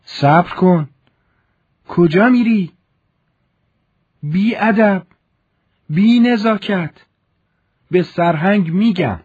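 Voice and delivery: high, loud, scolding cries; colonel's wife shouting threats after the fleeing servant; threatening colonel's wife. Acted. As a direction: scold him sharply as he walks away.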